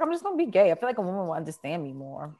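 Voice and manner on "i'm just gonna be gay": excited declaration. Retold or imitated. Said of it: "I was like".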